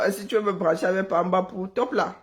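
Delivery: ordinary talk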